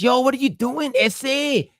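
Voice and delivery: high, exaggerated Chicano vato accent; crazy LA vato. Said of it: "like a crazy vato from L A".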